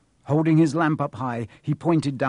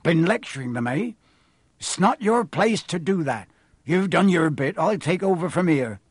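higher, louder rustic giant; disapproving groundskeeper; 4 semitones higher, 4 dB louder than usual. Acted. Groundskeeper dismissing the caretaker, indignant. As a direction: push back in a broad country accent.

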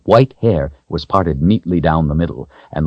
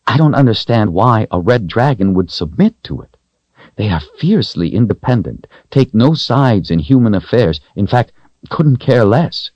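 lightly voiced woman explaining, higher pitch; a hint of a woman's voice, insistent; earnest woman character; 6 semitones higher than usual. Voices Kahlan in dialogue.

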